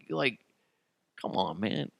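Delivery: normal talking voice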